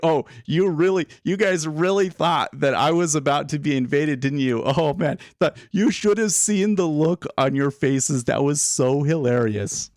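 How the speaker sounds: high-pitched, gleeful mock gloating; gloating prankster president. President teasing a market that fell for his joke, gleeful gloating. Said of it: "Ukrainian president being like".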